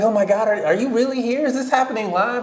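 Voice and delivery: high, excited fangirl voice; starstruck girl. Retold or imitated. Young fan meeting [name], high excited gushing. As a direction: gush like a girl meeting her idol. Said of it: "And she's like".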